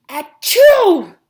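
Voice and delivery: loud, high explosive "achoo"; huge mock sneeze. A narrator's reading. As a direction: sneeze violently, exploding on the second syllable. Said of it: "Mister Gorf sneezed so hard".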